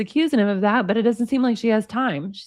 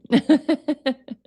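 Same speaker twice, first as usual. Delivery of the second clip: giggle; 4 semitones higher than usual. Real, not acted. Laughing at poop joke.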